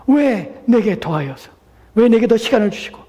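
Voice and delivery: high, insistent protest; complaining. Acted. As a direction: pile up aggrieved whys, easing at the end. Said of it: "라고 불평할 수 있겠습니까?".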